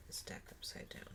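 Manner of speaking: a soft whisper while turning the deck over; whispered aside. Real, not acted. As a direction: whisper it under your breath. Aside while handling tarot deck.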